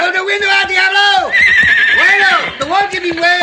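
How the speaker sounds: high excited yelling; shouting. Acted. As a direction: shout over the noise. Urging horse to pull wall.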